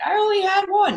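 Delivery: put-on high, defensive drunk mom. Daughter voicing her drunk mother's denial, high and defensive.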